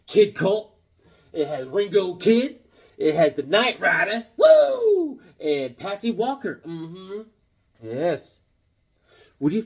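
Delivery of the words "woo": loud falsetto woo; high whoop. Pretend. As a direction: let out a high, excited whoop. Whoop after character name.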